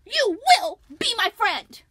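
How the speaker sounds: high, very loud insistent yell; demanding shout. Acted. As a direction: command it like a tantrum. Lucy shouting at egg.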